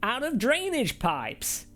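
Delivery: high, slow, hammed-up American accent; a terrible American accent imitation of Sean Cole; bad American accent. Mocking a fellow reviewer in a bad American accent. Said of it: "That's the best American accent I can do".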